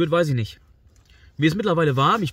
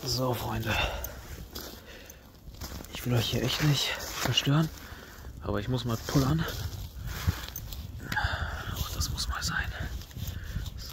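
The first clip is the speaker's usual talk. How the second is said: whispering; 8 dB quieter than usual. Real, not acted. Whispering at night in tent.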